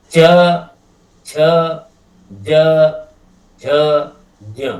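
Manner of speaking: च छ ज झ ज्ञ said loud with pauses between; teacher demonstrates the च row from the palate; palatal consonants. Shown as a demonstration. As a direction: sound each letter off the palate. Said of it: "ये कहाँ से हो रहा है".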